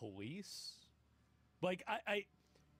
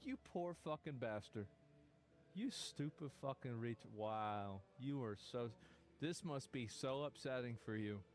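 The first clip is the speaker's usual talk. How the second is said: mock pity; 7 semitones lower, slower than usual. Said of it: "you think".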